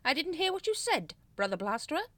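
high, loud pointed demand; menacing prompt. A narrator's reading. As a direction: press the culprit to answer. Grand Master demands louder reply.

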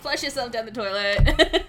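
laughing through a joke. Real, not acted. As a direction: say it while cracking up. Teasing joke amid laughter.

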